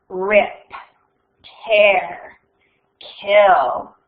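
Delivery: low, menacing monster voice; cold murderous voice. A narrator's reading. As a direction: speak like a hidden killer. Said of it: "the same cold, murderous voice".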